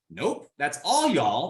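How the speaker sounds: "all y'all" said slowly, leaning on "all"; teaching the proper plural of y'all, "all y'all" slow with weight on "all"; stress on all y'all. Shown as a demonstration. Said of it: "That's how you say it properly".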